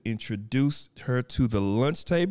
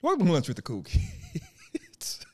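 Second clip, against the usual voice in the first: much higher and slower, laughing through it; sheepish giggly second take; laughing retry. Shown as a demonstration. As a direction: restart the opener, cracking up. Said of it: "I'm sorry, y'all".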